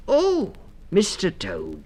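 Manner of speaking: high, drawn-out mother mouse; greeting the toad in relief; relieved cry. A narrator's reading. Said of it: "she cried".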